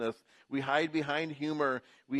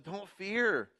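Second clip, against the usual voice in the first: Joseph reassuring; 6 semitones higher, slower than usual. Retold or imitated. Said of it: "he says to them".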